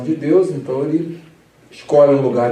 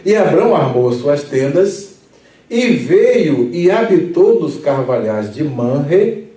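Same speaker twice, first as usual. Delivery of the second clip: scripture reading; 2 semitones higher, 4 dB louder, slower than usual. Acted.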